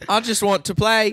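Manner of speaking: put-on high, childlike robot voice; Chappie.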